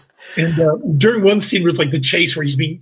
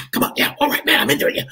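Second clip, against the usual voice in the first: high, eager acted burst of readiness; pumped-up psyching up; 10 semitones higher, faster than usual. Improviser revving himself up on his first set, pumped. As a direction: pump yourself up, half out of breath.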